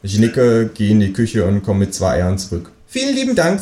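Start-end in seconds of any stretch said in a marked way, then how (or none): 2.9-3.6 a bright squeaky kangaroo hello. Acted. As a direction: kangaroo at the door greeting neighbour. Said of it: "sagt das Känguru".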